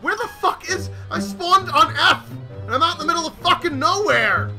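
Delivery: loud exasperated complaint; raging gamer. Real, not acted. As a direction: vent at the game's spawns. Bad spawn complaint.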